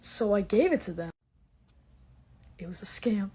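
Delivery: normal talking voice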